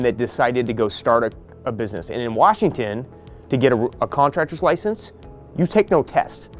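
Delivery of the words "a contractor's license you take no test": ordinary delivery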